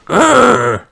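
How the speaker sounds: high playful "Grr"; mock growl; toy dinosaur growl. George growls.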